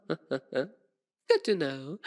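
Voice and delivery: chuckle, then a drawn-out, pleased reply; slow, chuckling vampire amusement; amused villain. Acted. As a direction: laugh smugly into the words.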